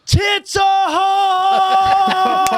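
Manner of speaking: long, high holler held out; bold shout. Retold or imitated. Bandmate hollering boldly over the mic at a passing woman, drawn-out shout. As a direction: belt it out loud, stretching every vowel. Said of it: "comes up on the mic all bold as can get".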